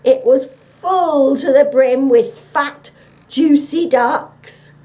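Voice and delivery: slow, loud, savoring; tempting. Acted. The dog luring the fox with tales of ducks, slow. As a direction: dangle the bait.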